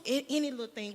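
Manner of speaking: usual speaking voice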